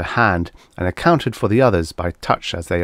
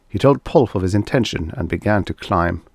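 ordinary delivery

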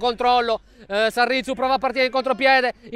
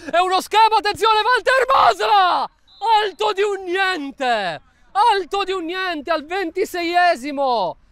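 shouted goal commentary; 7 semitones higher than usual. Real, not acted. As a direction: yell the chance, then draw it out. Near miss.

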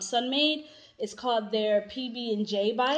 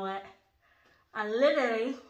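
talking while wiping teeth; fingers and tissue in mouth. Real, not acted. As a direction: mouth held wide open. Seen 2: She holds tissue pressed against her teeth, mouth wide open.

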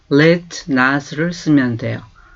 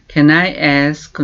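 slow model phrase; much slower than usual. Shown as a demonstration. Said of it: "teacher models the phrase".

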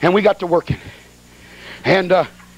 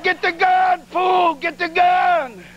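high, slow yelled order; frantic shout; 9 semitones higher, much slower than usual. Retold or imitated. Preacher yelling at laughing Herman, high frantic shout. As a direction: bark it at him in a panic.

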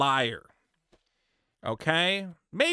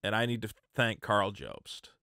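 mock grateful statement; 10 semitones lower, 4 dB quieter than usual. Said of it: "This video should want".

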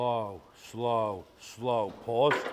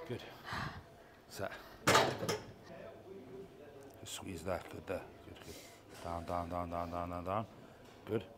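quiet coaching; 5 semitones lower, 10 dB quieter than usual. Real, not acted. Coach quietly cueing the set.

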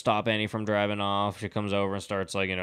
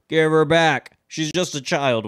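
mock scream, higher and louder; imitated scream; 6 semitones higher, 5 dB louder, slower than usual. Retelling a chick screaming after a kidnapping, mock scream. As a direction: raise it into a retold scream. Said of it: "screaming stuff like".

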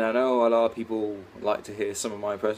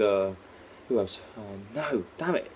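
ordinary talk, nothing marked

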